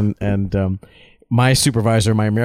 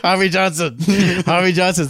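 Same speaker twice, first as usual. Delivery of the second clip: put-on higher Japanese-accented echo, dropped r; 6 semitones higher than usual. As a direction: imitate Japanese workmen confirming the name through amusement. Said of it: "they were like".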